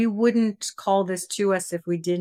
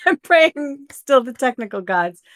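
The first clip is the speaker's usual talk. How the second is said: laughing; 6 semitones higher, 6 dB louder than usual. Real, not acted. Laughing about recording glitches.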